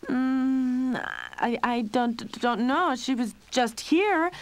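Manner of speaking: slow, high, halting nervous stammer; stuttering. A narrator's reading. Lionel covering for Lucy to his mother, stuttering. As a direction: stammer out the lie. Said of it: "Lionel was stuttering".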